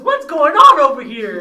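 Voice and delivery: a loud, laughing king's demand; booming king. Retold or imitated. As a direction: burst in like a king. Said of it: "he goes".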